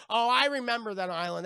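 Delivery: acted dismay, starting big; exclaimed recognition. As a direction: burst out with it, hammed up. Jack acted recalling island.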